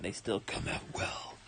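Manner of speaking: soft unvoiced whisper. Real, not acted. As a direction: breathe it out quietly. Voice gone, repeats quietly.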